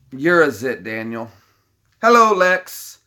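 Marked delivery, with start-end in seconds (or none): 2.0-3.0 villain greeting. Pretend. Villain impression.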